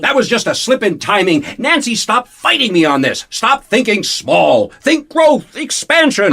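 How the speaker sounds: husband loudly overruling his angry wife; heated insistence. Acted. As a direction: shout her down, then sell the dream. Malcolm argues back with Nancy.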